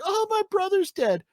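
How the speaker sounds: very high mock-weepy wail; badly faked tearful squeal; fake crying. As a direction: whine it like a bad actress faking grief. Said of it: "The worst fucking fake crying".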